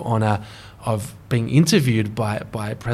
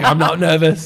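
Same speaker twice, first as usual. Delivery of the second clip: laughing mock Obama, louder; imitating Obama; 4 semitones higher, 7 dB louder than usual. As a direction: play the president coolly through a laugh. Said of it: "he's like".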